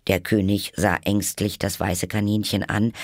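ordinary delivery, nothing marked

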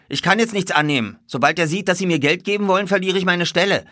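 high, loud stablehand voice; nervous stablehand. A narrator's reading. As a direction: refuse anxiously, afraid for your job.

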